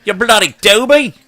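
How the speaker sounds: high, loud Aussie scolding; mock outrage in broad Australian; Australian accent. Shown as a demonstration. Host cursing over spilt coffee, Australian accent. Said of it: "do my Australian accent".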